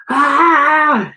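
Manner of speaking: sharp high startled scream. A narrator's reading. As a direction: let out a sudden terrified yell. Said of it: "you scream".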